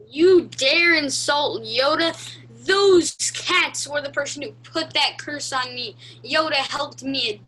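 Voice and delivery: loud, indignant defense of Yoda; a loud, wounded fan, outraged; offended outrage. Acted.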